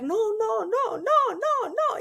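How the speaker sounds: high-pitched mock yell of a small child; imitated child's cry. Small child protests as two adults fight, high imitated yelling. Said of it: "Y empieza a gritar".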